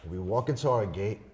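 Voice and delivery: ordinary talk, nothing marked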